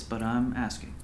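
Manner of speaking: soft, understated asking; gentle request. Acted. Pastor asking what angered him, softly. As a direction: ask quietly, without pushing.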